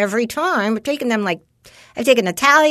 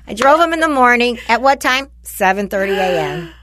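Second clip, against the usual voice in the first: usual speaking voice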